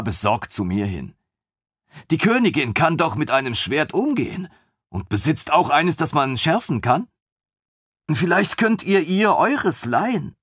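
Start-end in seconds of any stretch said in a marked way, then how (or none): 2.1-10.4 put-on high, bright-eyed young officer, gushing; a thrilled lieutenant who can't believe his luck; eager lieutenant voice. Lieutenant gushing over the queen's griffin, high and eager.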